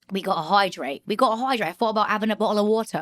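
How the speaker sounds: put-on English accent, clipped T's dropped. Shown as a demonstration. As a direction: try the prompt phrase like a Brit. Demonstrating her English accent again.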